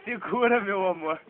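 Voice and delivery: alarmed. Real, not acted. Alarmed, child running.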